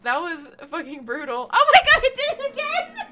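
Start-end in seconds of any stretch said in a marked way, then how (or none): 1.5-3.1 high, loud, shrieking with laughter; real disbelieving laugh-yell. Real, not acted. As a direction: squeal it through laughing. Creature falls again.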